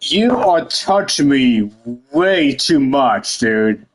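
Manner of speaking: ordinary talk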